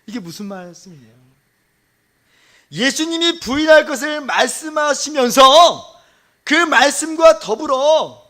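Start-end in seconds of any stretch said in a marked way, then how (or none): 2.7-8.2 loud, high, drawn-out proclamation; full-force preaching. Real, not acted. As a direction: cry it out, stretching the phrase endings. (길게 외치는 설교조)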